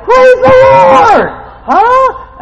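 high, loud, slow shout of praise; joyful exclamation. Retold or imitated. Preacher hearing about the twins, loud slow praise. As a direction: stretch out the praise in delight.

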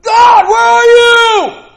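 loud, high, slow cry out to God; drawn-out shout to get God's attention; desperate cry. Retold or imitated. Jabez crying out to God, long loud shout. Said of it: "And when he cried".

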